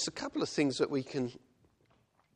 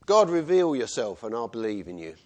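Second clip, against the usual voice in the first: loud challenge; 9 dB louder than usual. Retold or imitated. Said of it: "at the end of the talk he said".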